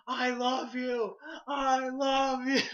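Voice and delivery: high, drawn-out mock-desperate scream. Screaming love after people walking away, high and drawn-out. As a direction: wail it loudly, stretching each word. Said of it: "I was screaming in German".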